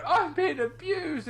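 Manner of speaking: high and drawn out, mocking a cry for help; mock whiny plea. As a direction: parody a fake victim through a laugh. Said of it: "you were going".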